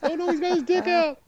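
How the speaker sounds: very high falsetto fake dismay; mock horror. Imagined crew reacting to the next shot, mock horror. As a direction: squeal it as a panicked crew member.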